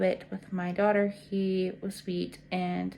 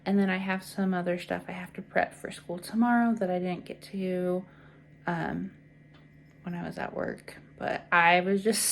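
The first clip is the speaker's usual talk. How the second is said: normal talking voice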